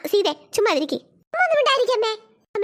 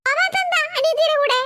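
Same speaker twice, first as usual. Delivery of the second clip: higher, slow questioning mother voice; stern mother; 5 semitones higher than usual. Acted. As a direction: press the son slowly like a suspicious mother. Mother questions older son. (സംശയത്തോടെ മകനോട് ചോദിക്കുന്ന അമ്മ)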